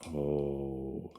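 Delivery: normal talking voice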